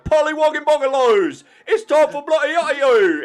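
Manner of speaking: high, loud hammed-up posh British gibberish; exaggerated mock British accent. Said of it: "They're all just like".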